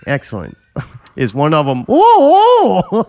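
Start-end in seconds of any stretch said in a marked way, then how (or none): 1.9-3.0 high, loud mocking "ooh ooh"; mock wail. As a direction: wail in hammed-up pain. Mimicking the victim's cry.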